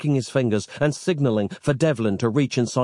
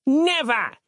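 bellowed. A narrator's reading. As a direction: fling out the word in anger. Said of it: "his ancestor bellowed".

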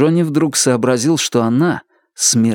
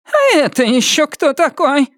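put-on shrill old woman voice. Henrietta voiced by narrator.

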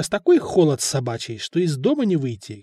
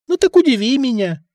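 higher, louder, eyebrow-raised dare; skeptical challenge; 8 semitones higher, 4 dB louder than usual. A narrator's reading. Stefan teasing Nadir, raised eyebrow skeptical dare. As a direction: go on, surprise me, teasing doubt. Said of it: "поднял бровь Стефан".

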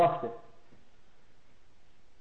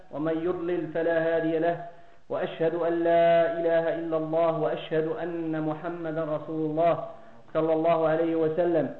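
Arabic opening recitation; 2 semitones lower, 3 dB quieter, much faster than usual. Acted. Preacher opening the lecture with praise of Allah, formal recitation. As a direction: recite the formula fluently in a measured monotone.

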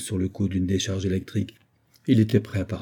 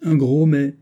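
mock exasperation; 5 semitones higher, 5 dB louder than usual. A narrator's reading. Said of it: "s'écria le capitaine Hod".

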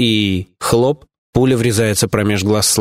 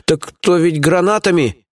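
higher, slowed-down feeble protest; mumbling excuse; 7 semitones higher, slower than usual. A narrator's reading. Lieutenant excuses himself to the boss, mumbling. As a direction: make a lame excuse, drawing it out. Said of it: "промямлил порученец".

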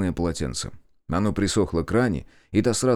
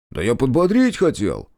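offended; 6 semitones higher, 4 dB louder than usual. Acted. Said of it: "оскорбился Олег".

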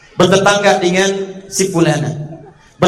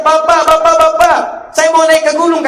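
much higher, louder call to his father; son calling out; 10 semitones higher, 5 dB louder than usual. Acted. Nuh's son calling to his father that he'll climb the mountain, shouting. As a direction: shout to your father from afar.

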